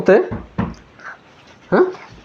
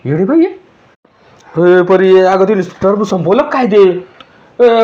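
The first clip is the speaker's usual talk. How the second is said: normal talking voice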